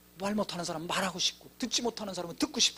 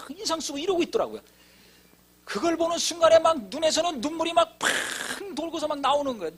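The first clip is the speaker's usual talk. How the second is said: high, loud, tearful preaching climax; impassioned preaching; 9 semitones higher, 6 dB louder than usual. Acted. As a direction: pour it out like a revival preacher at full cry. Testimony climax, preaching at full force. (높고 큰 소리로 울먹이며 열정적으로 설교하듯)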